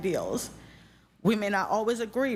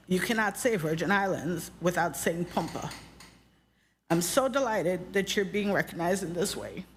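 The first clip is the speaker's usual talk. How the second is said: ordinary talk, nothing marked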